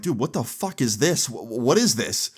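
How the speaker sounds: mildly stunned stammer; retold shock. Shocked at the pistol on desk.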